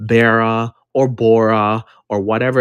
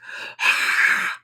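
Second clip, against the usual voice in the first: heavy sigh; 5 dB quieter than usual. Real, not acted. After being banned.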